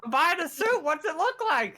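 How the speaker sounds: high, snippy character voice; sassy customer. Acted. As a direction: toss it back high and cheeky. Answering shopkeeper in character.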